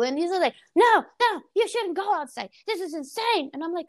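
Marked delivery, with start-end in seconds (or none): 0.8-3.5 high-pitched mock yelling, loudest on the first words; yelling mom. Mom forbidding daughter to go outside, high mock yelling. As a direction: imitate a mom's panicked scolding. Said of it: "like, yelling".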